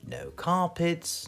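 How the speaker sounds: usual speaking voice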